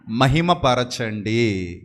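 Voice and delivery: very slow, low, stretched "మహిమపరచండి"; drawn-out emphasis. Acted. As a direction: draw out "మహిమపరచండి" slowly, low and falling. Preacher drawing out scripture word.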